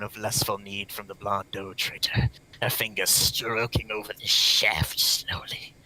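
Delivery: unvoiced mock-sultry whisper. Reading erotic passage whispered.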